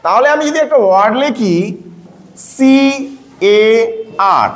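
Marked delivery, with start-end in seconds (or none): none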